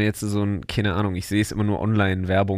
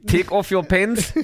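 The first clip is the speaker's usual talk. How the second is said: higher, slow, hammed-up German-accented English; 8 semitones higher, 3 dB louder, slower than usual. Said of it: "mit deutschem Akzent Englisch geredet".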